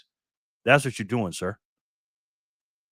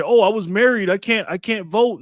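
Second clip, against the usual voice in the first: mocking whiny quote; 9 semitones higher, 6 dB louder than usual. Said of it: "when they say".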